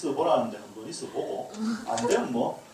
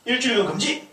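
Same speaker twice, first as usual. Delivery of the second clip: higher, louder mock decree; hot-tempered mom; 8 semitones higher, 4 dB louder than usual. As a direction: bark the ban like an angry mom. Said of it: "엄마가 조금 다혈질인 엄마는". (다혈질 엄마가 일주일 금지를 선언하는 큰 소리)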